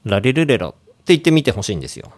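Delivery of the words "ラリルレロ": "ラリルレロ" with the mouth barely moving; tongue forward, sloppy "ラリルレロ"; lazy articulation. Shown as a demonstration. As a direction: say "ラリルレロ" carelessly without opening the mouth. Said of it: "いい加減な感じで".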